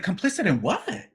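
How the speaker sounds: high-pitched indignant disbelief; incredulous. Retold or imitated. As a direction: react as if the accusation is absurd. Acts out disbelief at accusation.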